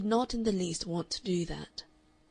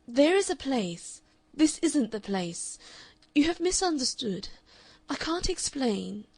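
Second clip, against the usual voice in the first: agitated lovers' quarrel; 5 semitones higher, 5 dB louder than usual. A narrator's reading. Heated exchange in opera box.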